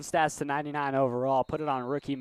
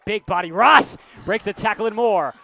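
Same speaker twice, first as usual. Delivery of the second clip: excited play call; 6 semitones higher, 10 dB louder than usual. Real, not acted.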